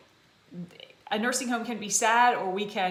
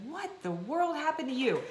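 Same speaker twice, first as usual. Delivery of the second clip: shocked mother; 7 semitones higher than usual. Retold or imitated. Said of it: "my mother was like".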